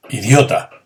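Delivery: mock loud insult; teacher demoing an exclamatory insult. Shown as a demonstration. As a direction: spit out the insult.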